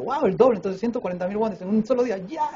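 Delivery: thrilled at double pay; excited wow. Retold or imitated. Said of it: "decía yo".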